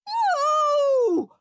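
put-on high, loud childish howl. A narrator's reading. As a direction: let out a huge howl of refusal. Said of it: "howled horrid Henry".

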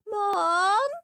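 high, loud whining call; wail. Acted. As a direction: tattle to Mum. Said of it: "wailed Peter".

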